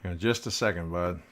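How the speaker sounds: talking around a marker in the mouth. Real, not acted. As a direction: speak with a pen between your teeth. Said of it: "I got this marker in my mouth".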